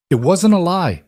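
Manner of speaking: high, pleading denial; voice cracking. A narrator's reading. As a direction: protest with a touch of a crack in the voice. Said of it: "Lena's voice cracked".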